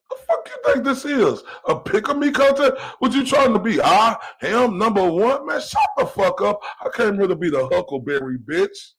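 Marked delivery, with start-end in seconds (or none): 0.1-6.6 much higher, laughing scorn; real mocking disbelief; incredulous mocking. Real, not acted. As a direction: hoot with disbelief at him.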